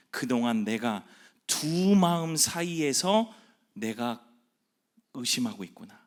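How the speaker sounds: ordinary talk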